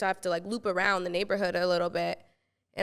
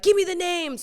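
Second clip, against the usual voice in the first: commanding godmother; 8 semitones higher, 3 dB louder than usual. Retold or imitated. Godmother's command on the phone, retold.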